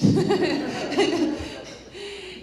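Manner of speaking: loud, high-pitched laughter; real laugh. Real, not acted. Host after mixing up the speakers, real laugh. Joking about mixed-up panel order.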